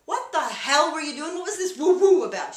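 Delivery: loud, high mock outrage; incredulous demand. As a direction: exclaim in exasperated disbelief. Retold confronting wife outside store.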